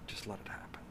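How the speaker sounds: breathy whispered repeat. Real, not acted. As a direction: drop to a whisper, pleading. Soft repeat after plea.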